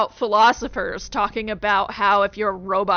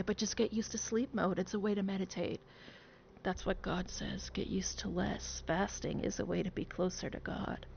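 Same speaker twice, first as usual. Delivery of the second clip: soft mock sermon; 2 semitones lower, 12 dB quieter than usual.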